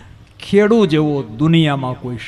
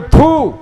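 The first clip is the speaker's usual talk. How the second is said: mock spit; 8 semitones higher, 9 dB louder than usual. Spitting at Kansa's bitterness.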